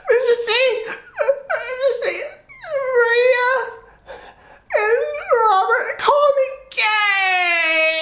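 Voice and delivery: put-on high, wailing little-boy sob; crying child. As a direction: imitate a hurt kid crying out to his teacher. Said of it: "and he's crying".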